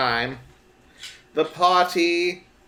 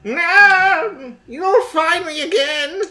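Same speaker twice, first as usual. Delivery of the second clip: mock wail; 9 semitones higher, 4 dB louder, slower than usual. Mock cry.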